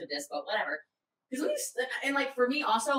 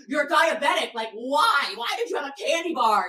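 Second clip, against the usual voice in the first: higher, louder retold telling-off; incredulous scolding; 6 semitones higher, 4 dB louder than usual. Partner scolds diabetic about candy bar, incredulous. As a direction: demand an answer in exasperation.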